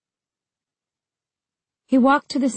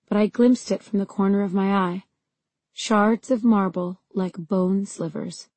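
ordinary delivery

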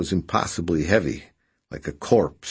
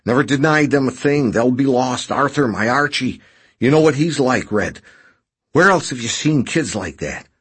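higher, louder, insistent character voice; desperate Burbage; 6 semitones higher, 5 dB louder than usual. A narrator's reading. Injured man pleading with his rescuer about his children, high and insistent. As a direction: push each plea out high and loud.

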